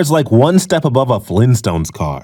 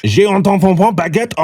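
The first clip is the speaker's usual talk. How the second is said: mock French; 3 semitones higher than usual. Tourist faking French for homeless man, mock French accent. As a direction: parody French, ending in a haughty laugh.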